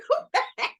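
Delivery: high, giggly order to retreat; laughing mock command. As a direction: playfully shoo it back through laughter. Acting out restoring her slipped mask.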